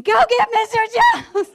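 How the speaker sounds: high, panicked call for help; retold screaming. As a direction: scream for backup, half laughing. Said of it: "I was screaming".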